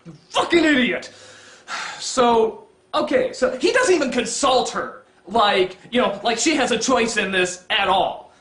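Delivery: ordinary talk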